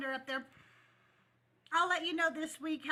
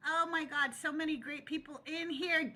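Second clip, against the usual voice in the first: normal talking voice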